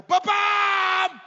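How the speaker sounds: very high loud burst; dad surprising family at supper; sudden yell. Retold or imitated. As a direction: explode it at the table. Dad's sudden dinner outburst.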